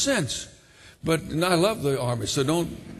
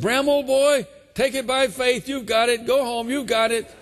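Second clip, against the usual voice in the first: put-on officer cheerily dismissing the young man at the altar; hearty dismissal; 8 semitones higher than usual. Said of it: "one of the men came over to him and said".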